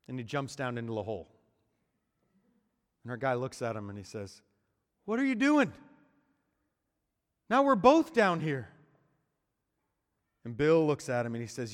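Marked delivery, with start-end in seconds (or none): 5.0-8.7 much higher, loud incredulous outburst; can't believe what he just did. Acted. Trapped man to friend who jumped in, loud disbelief.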